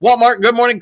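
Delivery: ordinary delivery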